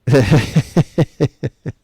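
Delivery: a real loud cackle at chat's joke; loud real laugh. Real, not acted. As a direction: burst of loud, high laughter. Laughing at the accent remark.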